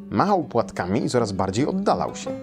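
usual speaking voice

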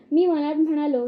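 plain reading voice

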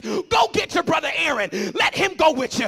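loud, high preacher voicing an exasperated God; frustrated shouting. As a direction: thunder out the orders, fed up with excuses. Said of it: "he got frustrated".

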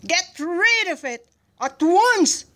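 high, loud, slow command; shouted order. A narrator's reading. General ordering gunner to remove duck, angry command. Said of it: "shouted the general".

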